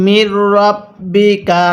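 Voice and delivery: drawn-out chanted reading of মির রব্বিকা; slow Quranic recitation. Shown as a demonstration. Teacher recites the verse word slowly for students.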